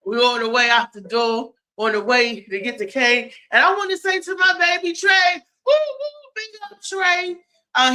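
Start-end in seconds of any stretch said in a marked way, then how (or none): 3.5-7.4 high, quick, whooping shout-out; excited shout-out. Real, not acted. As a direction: send a high, hollered cheer to someone absent. Shout-out to absent Trey.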